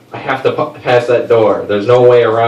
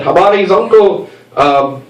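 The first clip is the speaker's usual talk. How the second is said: higher, made-up African-sounding phrases; fake Swahili; 6 semitones higher than usual. Comic wears cord and greets neighbor in mock Swahili. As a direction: improvise mock Swahili with gusto. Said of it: "I respond in a different accent".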